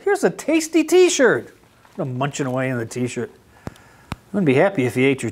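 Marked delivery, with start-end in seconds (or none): 0.0-1.5 high, friendly goat offer; a goat sharing its snack. A narrator's reading. Goat offering Duck a snack, high-pitched. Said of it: "said Goat".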